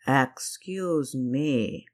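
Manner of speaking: very slow, restrained menace; hint of malice. A narrator's reading. Captain intercepting the Baron's slap, hint of malice. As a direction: draw it out with quiet malice. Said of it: "with only a hint of malice".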